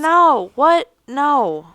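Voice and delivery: sharp, disbelieving no what; incredulous. Retold or imitated. Outraged at ghosting adopters.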